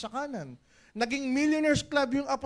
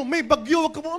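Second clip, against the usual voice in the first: shouted warning; 4 semitones higher, 8 dB louder than usual. Acted. Acted warning to a leader.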